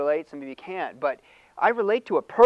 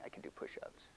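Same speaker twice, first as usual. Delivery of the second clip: whispered aside; 22 dB quieter than usual. Real, not acted. Self-mocking aside.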